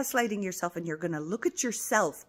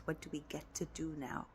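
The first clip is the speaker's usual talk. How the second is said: hushed, barely voiced question; 11 dB quieter than usual. Shown as a demonstration. Coach showing the viewer the new question, hushed. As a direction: murmur the question to yourself. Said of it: "quiet repetition of key question".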